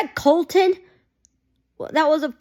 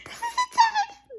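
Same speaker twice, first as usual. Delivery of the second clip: much higher, strained, teary squeak, slow; slower than usual. Real, not acted. Fan stunned by the twist, squeaky teary disbelief. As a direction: squeeze it out, near tears with excitement.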